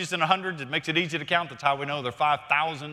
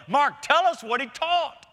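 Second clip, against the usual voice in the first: higher, slow plea to Mark; preacher playfully begging Mark, higher and slow; pleading; 9 semitones higher than usual. Acted. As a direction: beg the gospel writer for more.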